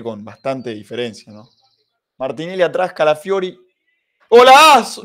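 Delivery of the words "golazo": huge shouted golazo; goal scream. Real, not acted. Goal celebration while watching.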